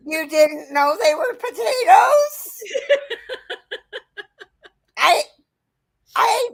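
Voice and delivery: high, laughing mock exasperation; laughing disbelief. Mother teasing her daughter about potatoes, laughing high. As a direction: tease her through laughter. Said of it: "I'm like".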